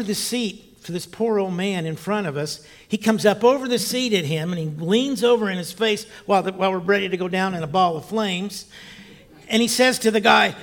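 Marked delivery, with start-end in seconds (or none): none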